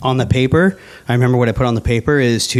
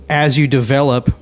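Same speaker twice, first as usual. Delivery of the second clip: ordinary talk, nothing marked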